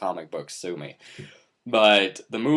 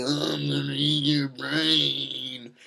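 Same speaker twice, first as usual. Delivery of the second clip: lightly voiced zombie; 4 semitones higher, slower than usual. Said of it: "They just kinda shamble around and like".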